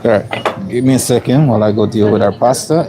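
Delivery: ordinary talk, nothing marked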